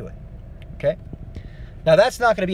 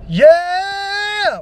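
high rough sung scream; fry scream attempt; 9 dB louder than usual. Shown as a demonstration. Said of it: "when I go into that part".

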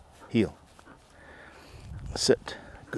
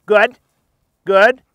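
bright, much higher, louder praise; high happy praise; 11 semitones higher, 13 dB louder than usual. Real, not acted. Trainer praising retriever's good work, high excited praise. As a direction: cheer the dog on, high and loud.

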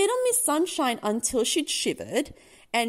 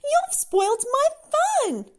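high, loud, slow complaint; protesting cry; 14 semitones higher, 6 dB louder than usual. A narrator's reading. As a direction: wail it like a thwarted child. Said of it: "he cried".

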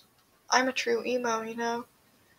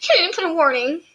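higher, faster mock whine of a triggered viewer; whiny complaining viewers; 7 semitones higher, faster than usual. Vlogger mocking viewers who will complain about no warning, whining. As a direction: whine it like an offended commenter. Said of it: "they're gonna be like".

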